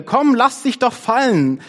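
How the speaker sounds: high, loud coaxing calls; shouting friends. A narrator's reading. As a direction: cheer a friend on from below. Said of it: "schrien die Freunde".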